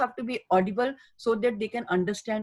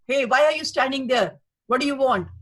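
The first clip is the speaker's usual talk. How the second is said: loud, higher, harsh demand; shouting; 4 semitones higher, 6 dB louder than usual. Shown as a demonstration. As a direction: bark it at someone in the ward. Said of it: "if I shout and say".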